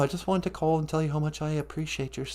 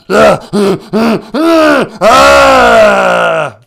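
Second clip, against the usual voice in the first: much higher shouted slow monster growl; Cookie Monster; 10 semitones higher, 16 dB louder than usual. Acted. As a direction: bellow for cookies like a ravenous monster. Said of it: "take it easy".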